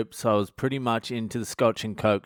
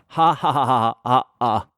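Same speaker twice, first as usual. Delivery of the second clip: loud, put-on "ha ha ha" laugh; hammed-up retold group laugh; mock laughter; 5 dB louder than usual. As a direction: act out everyone having a laugh. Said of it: "we all had a laugh".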